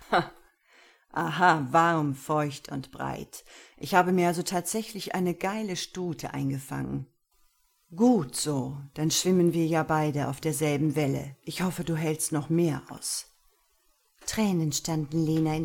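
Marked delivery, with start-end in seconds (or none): 0.1-1.7 short spoken mock laugh, louder. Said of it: "Dann lachte er". 1.7-13.3 dominant master. Acted. Master's voice in the story.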